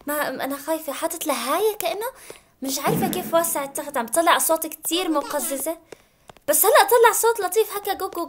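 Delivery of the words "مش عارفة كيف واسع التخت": normal talking voice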